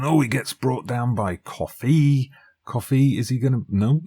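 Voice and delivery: sudden loud commentary call; excited outburst. Real, not acted. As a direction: shout it as the foul happens. Foul during live commentary.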